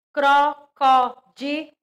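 careful classroom modelling of Crocodilo; slow syllable demo. Shown as a demonstration. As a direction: spell out Crocodilo for children, syllable by syllable. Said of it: "teacher models example word".

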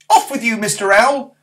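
high, loud and cross; boy crossly telling off an owl. Acted. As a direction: scold the owl like a small boy. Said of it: "said Barney crossly".

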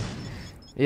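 ordinary delivery

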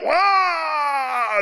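long high team yell; high drawn-out charge yell, acted; hammed-up battle yell. Player running out of the locker room, hammed-up battle yell. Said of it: "you run out of the locker room and you're all".

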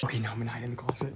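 hushed whisper so she sleeps. Real, not acted. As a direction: whisper it close to the mic. Hiding in the closet.

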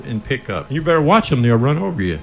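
ordinary delivery

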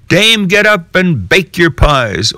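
high rooster call; crowing cock. Acted. Cocks crying out to wake the dame, high. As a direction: crow it like a cock. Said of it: "the cocks cried out".

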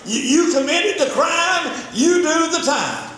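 hammed-up family scolding; mocking shout. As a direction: bark it like a judgmental relative. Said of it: "that's what the modern family says".

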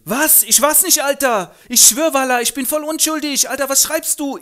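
sehr hoch, laut, prollig; Straßengöre. Acted. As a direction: patzige Göre im Jugendslang. Eliza panisch vor dem Notierer. (hohe, laute Prollstimme)

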